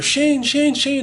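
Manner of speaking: high, loud call across the street. Retold or imitated. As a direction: shout his name to find him. Friend calling his name.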